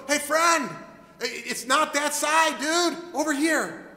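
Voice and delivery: casual shouted correction, dude; calling out. Acted. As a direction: wave a friend over to the right spot. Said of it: "Jesus is going".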